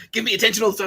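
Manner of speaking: high, loud mock demand for attention. Ex-fling demanding attention, mock tantrum. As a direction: imitate a shrieky attention-seeker.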